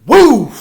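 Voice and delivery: loud, high whoop; triumphant whoop. Retold or imitated. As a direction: let it rip on the hit. Big final punch.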